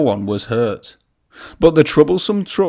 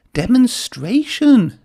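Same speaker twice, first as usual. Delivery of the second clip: much higher, slow cheerful engine voice; young engine voice; 11 semitones higher, 3 dB louder, slower than usual. A narrator's reading. Thomas explaining the word to Percy, high and bright. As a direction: spell it out like a bright little engine. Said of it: "said Thomas".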